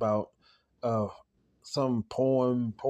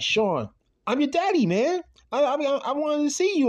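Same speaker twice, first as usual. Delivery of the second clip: high-pitched, insistent dad plea; raised, urgent imitation of a dad; pleading father; 13 semitones higher, 3 dB louder than usual. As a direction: act out a father appealing to his son. Voices father pleading with son.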